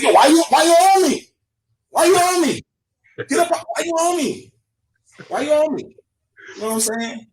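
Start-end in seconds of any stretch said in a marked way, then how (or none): 0.0-6.0 much higher hammed-up complaint; mock annoyed yell. Guy in the club bumping into him, high mock annoyed yell. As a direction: push back at the guy, mock angry. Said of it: "I said".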